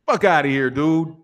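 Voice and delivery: normal talking voice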